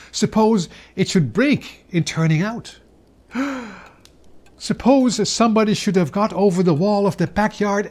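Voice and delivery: rising worry. A narrator's reading. Anxious worries about the pudding.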